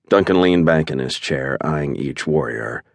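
ordinary delivery

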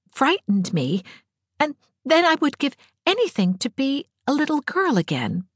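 put-on high, plaintive girl's voice with halting pauses; Diana, uneasy. A narrator's reading. Diana admitting growing up frightens her, plaintively. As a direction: confess it haltingly, pitched well up.

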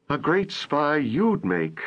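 slow, high-pitched scolding of herself; self-disgust. A narrator's reading. Holly scolding herself in disgust, slow and mocking. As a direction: mutter it like you can't believe how hopeless you are. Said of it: "she told herself in disgust".

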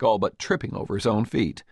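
ordinary delivery

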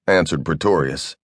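ordinary delivery, nothing marked

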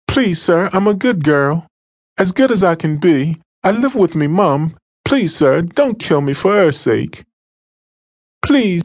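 high, desperate pleading voice; terrified girl begs her attacker not to kill her; begging girl. Acted. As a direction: beg for your life in a girlish voice. Captive girl begs for life.

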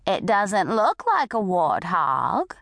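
put-on slow, swooping old lady voice; doubting old lady. A narrator's reading. Old lady doubts the bully, slow swooping old voice. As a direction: draw it out and lift the end in polite doubt.

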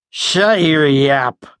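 slow growl. Acted. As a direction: growl it slowly at him. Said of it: "he growled at Penner".

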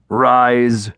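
drawn-out commanding chief's order; solemn, resonant command; booming chief. A narrator's reading. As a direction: boom it out as a stern chief. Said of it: "the chief's voice boomed".

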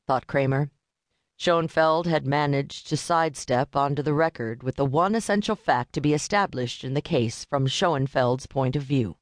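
plain reading voice

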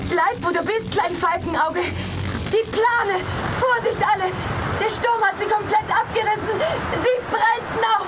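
high, loud panicked warning; panicked under fire. Acted. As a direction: shout the alarm over the blaze. Fire and storm, warning all.

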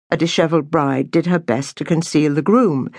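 ordinary delivery

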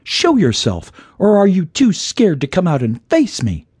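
high-pitched, defiant challenge; heroine's high, challenging call. A narrator's reading. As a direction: dare a hidden foe to come out. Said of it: "she called out".

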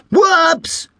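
high, sudden yelp; surprised whoops. A narrator's reading. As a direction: blurt it as it slips. Toy flies from hand.

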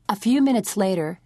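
usual speaking voice

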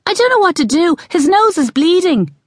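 put-on high, upset boy's voice; alarmed child. A narrator's reading. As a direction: cry it out high to mother. Boy alarmed over hurt brother.

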